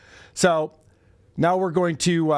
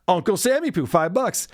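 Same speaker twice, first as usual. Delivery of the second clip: normal talking voice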